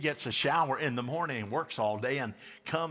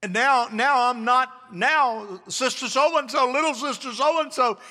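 loud, high-pitched sermon delivery; raised preacher's voice; preaching at full force; 7 semitones higher, 6 dB louder than usual. Real, not acted.

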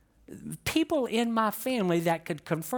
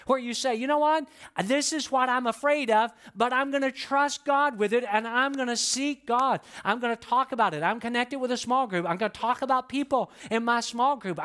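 raised, urgent preacher's push; fiery preaching; 6 semitones higher than usual. Acted. Preaches at full force.

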